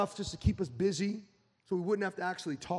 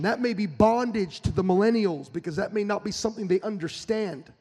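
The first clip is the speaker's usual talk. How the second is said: louder, driving preacher's cadence, building up; full-force preaching; 4 semitones higher, 6 dB louder than usual. Acted. Pastor urging the church to change its delivery, preaching at full force. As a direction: preach it out hard to the congregation.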